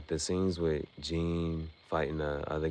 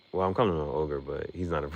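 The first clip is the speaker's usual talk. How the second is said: usual speaking voice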